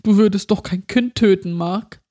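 lightly voiced pleading child, rising on Mark; trembling child voice. A narrator's reading. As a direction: beg him, still a scared little girl. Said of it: "Jetzt zitterte die Stimme wieder".

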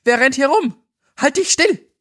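high, panicked yell in the dark; shouting. Acted. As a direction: scream the order to stay still. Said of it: "schrie ein anderer".

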